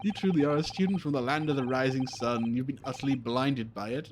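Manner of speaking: grand, condescending sleuth; amused theatrical detective. Acted. Detective chiding his student from abroad, amused and theatrical. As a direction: tease your pupil with great pomp. Plays Sholmes teasing pupil.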